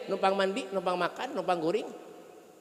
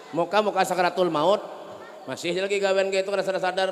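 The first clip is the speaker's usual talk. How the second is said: ordinary delivery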